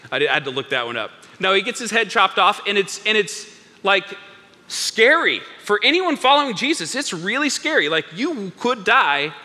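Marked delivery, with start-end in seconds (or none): none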